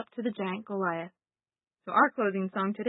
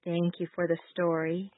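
prayer; 3 semitones higher, 3 dB quieter than usual. Acted. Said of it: "you can repeat after me".

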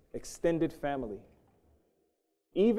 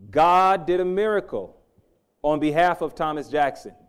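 preaching; 7 dB louder than usual. Acted. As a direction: proclaim it to the surgeon with conviction. Preacher booms his words to the surgeon.

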